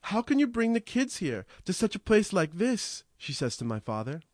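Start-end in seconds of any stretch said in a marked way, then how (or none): 0.0-3.0 put-on high, pleading old woman, much higher voice; frantic plea. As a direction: beg the father, panicked and high. Grandma confronts father in panic.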